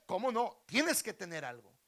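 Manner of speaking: forceful royal insistence, very loud; loud rebuttal. Acted. King refusing the beggar's excuse, loudly insisting. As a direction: contradict him at full volume.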